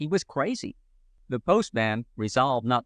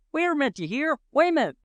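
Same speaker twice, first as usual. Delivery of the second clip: frantic yell; 12 semitones higher, faster than usual. A narrator's reading. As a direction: yell it up high in a panic, mouth still half full. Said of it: "Pee-wee yelled".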